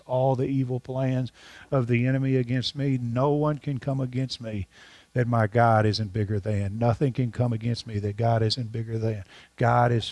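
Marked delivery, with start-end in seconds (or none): none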